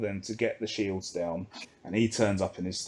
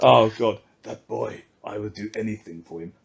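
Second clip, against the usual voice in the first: mock Hondo voice. Mocking Hondo's fondness for Ezra.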